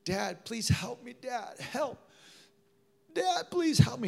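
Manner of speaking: high-pitched mock panicked plea; imitated cry for help. Father imitates his son bursting in at night, panicked. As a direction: act out a frightened child calling Dad. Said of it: "He shouted".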